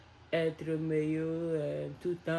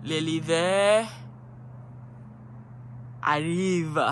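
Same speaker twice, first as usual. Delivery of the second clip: louder, slow, portentous "L'hiver arrive" with long pauses; dramatic announcement; 4 dB louder, much slower than usual. Pretend. Looking at the fog, to viewers, mock ominous announcement. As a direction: declaim it like an ominous warning. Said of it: "Je vais dire que l'hiver arrive".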